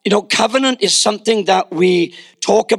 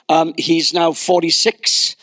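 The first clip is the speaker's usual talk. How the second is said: ordinary delivery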